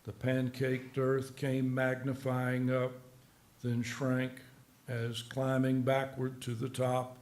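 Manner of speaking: slow, measured poem recital; soft, deliberate verse reading. Acted. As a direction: read the poem evenly, pausing at each phrase. Reads his poem aloud.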